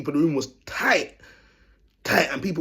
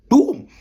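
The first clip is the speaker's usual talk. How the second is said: loud, high shouted boom; shotgun boom; 12 semitones higher, 8 dB louder than usual. Pretend. Teller acts out a shotgun blast at himself, shouted loud.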